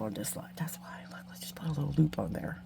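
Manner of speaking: conspiratorial whispered aside. Real, not acted. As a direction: lean in and whisper it.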